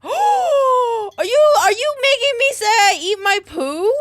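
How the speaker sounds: gasp, then scream the accusation; shocked outrage. Real, not acted. Streamer reacting to a pet's message with a gasped, high shout.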